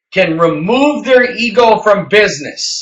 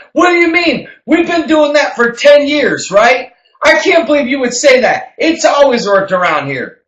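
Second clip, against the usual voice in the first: higher, louder mock indignation; indignant business owner; 8 semitones higher, 4 dB louder than usual. Owner hears his process criticised, indignant and incredulous. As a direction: protest as an affronted old-timer. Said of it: "it's like someone coming in and saying".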